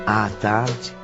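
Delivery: normal talking voice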